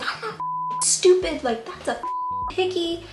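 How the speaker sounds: high-pitched scolding mom, no real shout; retold yelling. Mom catching her daughter's hickey, retold yelling. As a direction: imitate an exasperated mom telling off. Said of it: "She was yelling, okay, profu".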